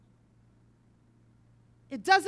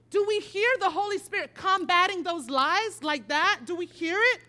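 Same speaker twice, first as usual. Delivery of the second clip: ordinary delivery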